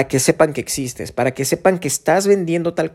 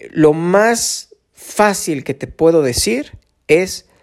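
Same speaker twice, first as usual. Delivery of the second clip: ordinary talk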